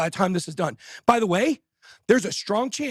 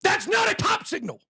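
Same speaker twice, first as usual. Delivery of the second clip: high, slow emphatic rant; high-pitched ranting yell; ranting shout; 7 semitones higher, slower than usual. Real, not acted. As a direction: hammer it home at the critics. Ranting at critics.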